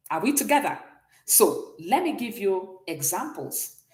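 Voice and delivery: ordinary talk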